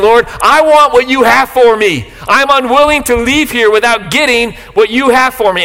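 high, fervent plea to the Lord; earnest prayer. Pretend. Pastor models the congregation's prayer, fervent and high. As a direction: pray it as if you truly mean it.

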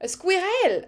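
high-pitched driver impression in a French accent; Esteban. Fan trying out a French driver's voice, high exclaimed impression. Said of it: "I can actually do Esteban".

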